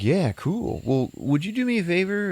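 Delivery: high-pitched imitation of a kid. Kid asking a visitor for a favor, imitated high kid voice. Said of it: "the kid's like".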